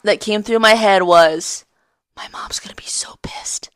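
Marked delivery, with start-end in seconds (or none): 2.1-3.7 breathy whisper of dread; whispered thought. Retold or imitated. Whispering her panicked first thought to a friend. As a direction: whisper it like a guilty kid.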